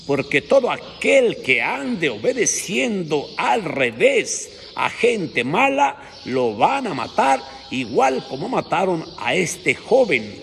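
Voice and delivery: preaching warning. Acted. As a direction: lean into the warning slowly.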